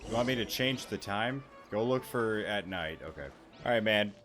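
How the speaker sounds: ordinary delivery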